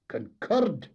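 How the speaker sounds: loud, high scornful echo; contemptuous snort. A narrator's reading. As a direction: scoff at the word. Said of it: "with a contemptuous snort".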